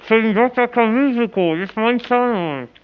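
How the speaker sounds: muffled, mumbling through dental packing; mouth full. Pretend. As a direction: garble the words round gauze. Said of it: "Ich hab eine Tamponade im Mund".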